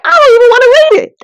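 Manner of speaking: whiny refusal. Retold or imitated. Vlogger retelling refusing to read the Bible, in a high fast whine. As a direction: whine it like a stubborn kid. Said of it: "But I was like".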